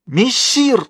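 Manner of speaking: very high, anguished call; heart-rending cry. A narrator's reading. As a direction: cry out in despair, pitch soaring. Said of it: "душераздирающе выкрикнул Фалуа". (душераздирающий высокий выкрик)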